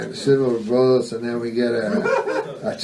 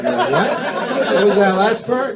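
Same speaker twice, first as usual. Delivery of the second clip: high, incredulous what; playful disbelief; mock double take; 8 semitones higher than usual. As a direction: pretend you just caught the good part. Feigns mishearing.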